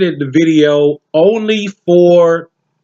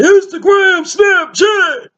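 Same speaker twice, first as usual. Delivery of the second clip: shouted quote; slower than usual. Retold or imitated. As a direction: yell it like the bystander. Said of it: "before you start hearing".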